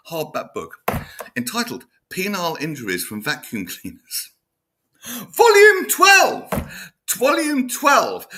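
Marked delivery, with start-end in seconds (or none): 5.0-6.5 slow, high, stretched-out cry of disbelief; drawn-out howl of astonishment; incredulous shout. Real, not acted. Comic reveal of absurd book title. 7.1-8.3 high, loud, slow but less stretched repeat; slightly calmer echo of the shout; shouting again in disbelief. Real, not acted. Repeats the punchline for effect.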